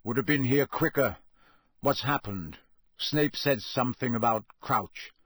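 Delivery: usual speaking voice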